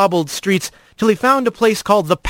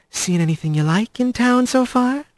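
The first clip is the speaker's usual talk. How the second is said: higher, suggestive lilt rising at the end; flirty questioning; 5 semitones higher, 3 dB quieter than usual. A narrator's reading. Laris fishing for the newcomer's interest, flirting. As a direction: ask it as a come-on. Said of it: "and her questions like".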